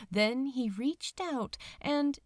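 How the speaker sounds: ordinary delivery, nothing marked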